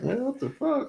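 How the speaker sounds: very high, softened squeal of protest; retold disbelief. Man retelling being told on to mama, disbelief. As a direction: imitate a dismayed high-pitched reaction.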